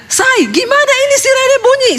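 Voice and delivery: high, pushed-up cry of alarm; retold panicked shout. Husband shouts to his wife about the siren in a high panicked voice. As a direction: call out in a panic from outside the bathroom. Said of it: "Suami saya teriak".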